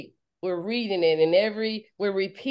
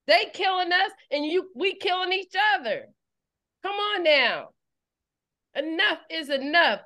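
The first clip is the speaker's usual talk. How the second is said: fervent preaching at full force; 5 semitones higher than usual. Acted. Preacher rousing her congregation, fervent preaching. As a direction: drive the message home, rising and urgent.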